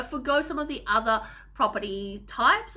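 ordinary delivery

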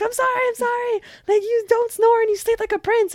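very high, mock-frantic apology; hammed-up high-pitched pleading; squealed apology. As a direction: squeal it out as a playful surrender. Acted squealed apology to partner.

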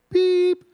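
high, loud mock bleep; censor beep. Son's swear word, as a high censor beep. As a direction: imitate the bleep that covers a swear.

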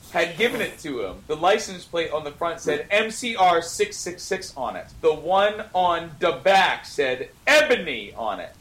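ordinary talk, nothing marked

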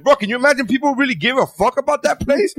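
high-pitched incredulous mockery; mock disbelief. Mimicking future people laughing at a fad, mock disbelief.

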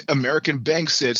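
ordinary talk

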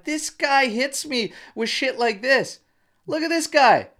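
high teasing mockery. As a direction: rib him like a heckling buddy. Co-host mocking him on camera.

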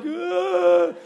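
high loud cry of emotion; a sobbing ugh; mock overcome wail; overwhelmed groan. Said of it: "they're like".

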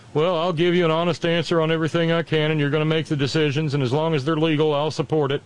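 high, brisk put-on voice; Mark Milley. General pledging honesty to his boss, high quick impersonation. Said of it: "Mark Milley, according to the book, said".

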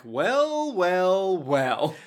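sly mocking well well well; gloating villain. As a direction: savor it like a smug villain.

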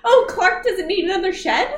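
real surprise as it clicks; dawning realisation. Real, not acted. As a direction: suddenly get it and check back. Works out the misheard word.

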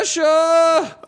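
high, loud screamed name; mock movie scream. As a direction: wail the name like a movie hero. Friend echoes the movie scream.

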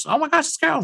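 light up on finding a lost friend; squealing oh my gosh; excited gasp. Retold or imitated. Heroes find Carol, happy reunion.

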